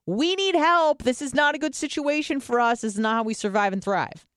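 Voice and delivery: high, pleading voice of a panicked inner brain; ancestral brain voice. Retold or imitated. The speaker voices the ancestral brain begging for help, high and urgent. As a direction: sound like your primal brain crying for help. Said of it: "That's your ancestral brain saying".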